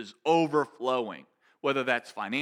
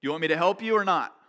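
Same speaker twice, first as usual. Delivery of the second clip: raised, pressing voice of Jesus; Jesus calling out; 6 semitones higher, 5 dB louder than usual. Retold or imitated. Jesus asking people to listen, raised insistent voice. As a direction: challenge them directly, higher and louder. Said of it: "'cause Jesus is saying".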